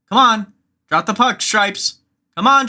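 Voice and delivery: ordinary talk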